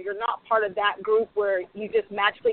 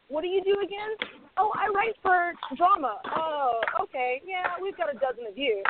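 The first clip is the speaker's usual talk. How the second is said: higher, mildly dismissive exec voice; a casting exec brushing her off, higher pitched; mock bored exec; 7 semitones higher than usual. Exec voice in retold meeting.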